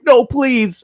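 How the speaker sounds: very high-pitched begging imitation; mock pleading. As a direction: squeal it like a panicked victim, hammed up. Victim begs the killer.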